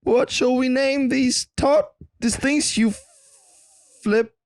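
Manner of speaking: high, drawn-out pondering voice; halting, high-pitched naming attempt; flip-flop inventor. Inventor puzzling over a name for flip-flops, high pondering voice. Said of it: "the guy who named flip-flops".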